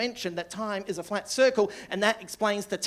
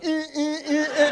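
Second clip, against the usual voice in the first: higher, slow honking uh sounds, laughing; drunk alarm call; 8 semitones higher, 4 dB louder, slower than usual. Retold or imitated. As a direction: mimic the drunk's alarm grunts.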